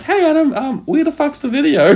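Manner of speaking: high-pitched put-on voice for a pestering sponsor; mock chasing message. Voices company's message chasing his video.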